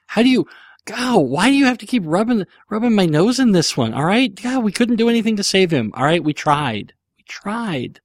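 high-pitched, hammed-up anguish; put-on wounded whine, sinking at the end; mock distress. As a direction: protest as if still grieving a lost pet. Mock upset over dead guinea pig.

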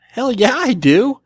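high-pitched and slow, eager agreement; excited yes. Retold or imitated. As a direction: draw out a delighted yes. Eager answer to the neighbor's offer.

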